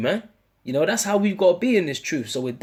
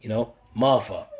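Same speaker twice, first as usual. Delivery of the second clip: calming her down; 6 semitones lower than usual. Shown as a demonstration. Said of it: "you gotta say their name not just once but twice".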